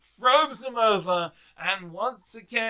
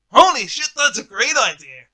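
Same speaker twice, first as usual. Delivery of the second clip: excited exclamation; 4 semitones higher, 10 dB louder than usual. Real, not acted. Sudden excitement at own idea.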